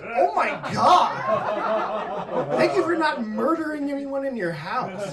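mock grateful; sarcastic relief. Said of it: "I was like".

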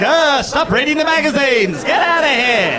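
high mock shopkeeper scolding; mock angry. Shopkeeper shooing loiterers, mock angry. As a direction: shoo them out in a hammed-up gruff tone. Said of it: "just be like".

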